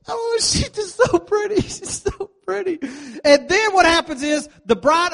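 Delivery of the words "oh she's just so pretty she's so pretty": high, hammed-up teary voice; mock crying. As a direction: fake a weepy wedding guest. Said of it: "Ladies start crying on cue".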